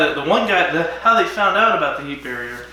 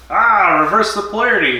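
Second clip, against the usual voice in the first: Doctor's catchphrase; 6 semitones higher, 4 dB louder than usual. Retold or imitated.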